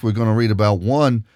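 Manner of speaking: usual speaking voice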